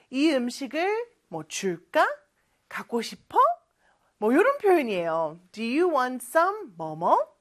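ordinary delivery

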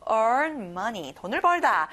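normal talking voice

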